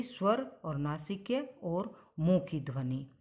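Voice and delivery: normal talking voice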